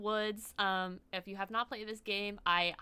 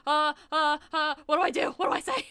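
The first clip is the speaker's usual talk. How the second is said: 1 mock panic; 5 semitones higher, 8 dB louder than usual. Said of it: "I'm like".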